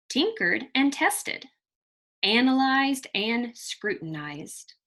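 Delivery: put-on lively read-aloud storytelling for children; expressive children's story reading; story narration. A narrator's reading. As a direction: read it like a picture book to a class. Teacher reads picture book aloud.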